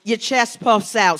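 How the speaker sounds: ordinary talk, nothing marked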